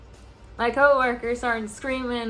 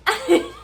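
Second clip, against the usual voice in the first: sharp high burst of laughter; 11 semitones higher, 5 dB louder than usual. Real, not acted. Telling off the boss, high burst of laughter. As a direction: laugh loud at your own comeback. Laughing after own comeback.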